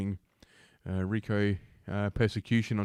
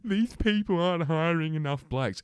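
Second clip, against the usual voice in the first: mocking quote; 7 semitones higher than usual. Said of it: "you're just gonna go around saying".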